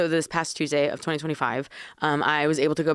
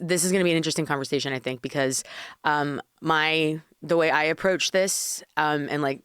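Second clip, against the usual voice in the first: usual speaking voice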